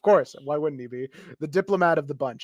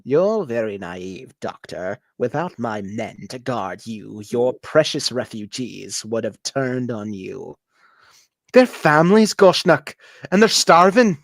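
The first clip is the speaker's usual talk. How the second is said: a petty warlord sneering at the visitors; 3 semitones lower, 4 dB louder than usual. Acted. GM voices warlord Goshnock.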